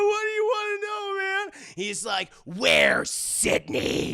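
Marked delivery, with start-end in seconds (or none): 0.0-1.6 high, loud panicked yell; hammed-up victim shout; terrified shout. 2.4-4.1 much lower, very slow menace; slow deep villain interrogation; deep killer voice. Retold or imitated.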